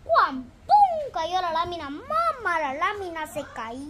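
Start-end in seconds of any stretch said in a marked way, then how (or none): 0.0-1.1 high, loud crash noise "cuan, pum"; playful sound effect of a crash; imitated crash. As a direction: bang it out like falling metal.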